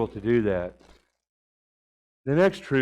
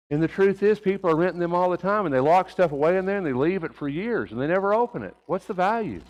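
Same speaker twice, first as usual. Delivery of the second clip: much higher and louder, ringing sermon delivery; full-force preaching; 6 semitones higher, 4 dB louder than usual. Acted. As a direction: preach it out to the congregation.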